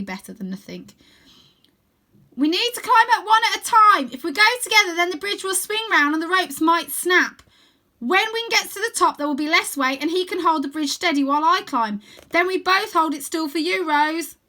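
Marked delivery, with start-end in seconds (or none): 2.4-14.4 high, loud urgent planning; boy explaining urgently; lightly voiced boy. A narrator's reading. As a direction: lay out the plan fast. Arthur explains plan.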